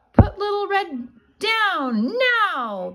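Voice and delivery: high, stretched stern warning; warning woodcutter. Acted. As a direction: order the wolf off her. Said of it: "the woodcutter warned".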